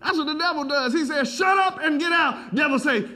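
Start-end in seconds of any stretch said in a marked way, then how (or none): 1.3-2.5 commanding shout. Retold or imitated. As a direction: bark it out like a command. Jesus rebuking devil, shouted.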